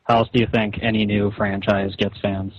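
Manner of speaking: normal talking voice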